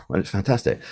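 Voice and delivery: ordinary talk, nothing marked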